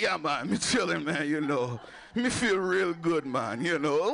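laid-back mock Jamaican accent; comic Jamaican stoner accent. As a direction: put on a chilled-out Jamaican accent. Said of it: "developed a Jamaican accent".

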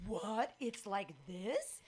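gasp, then a drawn-out rising question; acted astonishment. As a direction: gasp and question it in mock disbelief. Acts out her shock back then.

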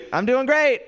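high-pitched little cheer; mock reassurance. Crowd tells comic he's doing great, high mock cheer. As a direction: pipe it up high, jokingly upbeat.

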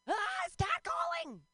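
very high, slow mock wail; stretched-out high shriek. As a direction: squeal it in pretend alarm. Mock alarm at being catcalled.

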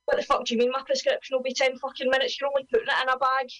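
high, fast indignant rant; angry customer. Retold or imitated. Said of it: "It's how the".

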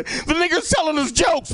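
high yelled mock police call; mock panicked call. Audience member calling the police, mock panicked high yell. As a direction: squeal it like a scared caller phoning cops. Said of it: "call the police right now and say".

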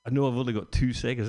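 normal talking voice

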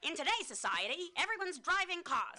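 high, loud 1950s newsreel announcer; old-timey voice. Shown as a demonstration. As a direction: boom it out like an old ad narrator. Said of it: "I'm gonna use an old-timey voice".

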